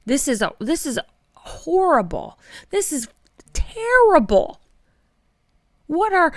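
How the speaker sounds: boil over on terrible, pitch cracking up high; real exasperated outburst climbing to a cry; getting worked up. Real, not acted. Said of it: "I'm getting worked up".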